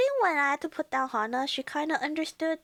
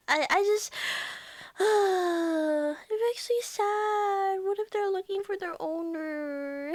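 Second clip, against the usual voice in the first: high, slow, wavering on the edge of crying; near tears; 5 semitones higher than usual. Real, not acted. Watching a lost ghost dog, near tears. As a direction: voice breaking as she holds back tears. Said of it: "I'm gonna start sobbing".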